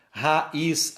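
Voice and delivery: "Raiz" split as ra, then iz; syllabes séparées. Shown as a demonstration. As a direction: pause between a and i in "Raiz". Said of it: "a mesma coisa".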